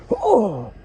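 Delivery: high startled whoa; strained exclaim lifting; surprised shout. Real, not acted. Lifting heavy dog.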